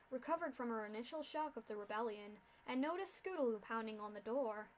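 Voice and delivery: ordinary delivery, nothing marked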